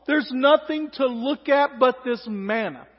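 loud, slow, drawn-out moaning about the food; melodramatic whining. Acted. Israelites grumbling to Moses about the manna, melodramatic and loud. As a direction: ham up the grumbling, slowly and loudly. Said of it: "A little melodramatic".

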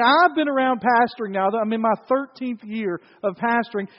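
loud, driving sermon delivery; full-force preaching. Acted. Pastor building up to his point for the church, preaching loud. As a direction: preach it out to the congregation.

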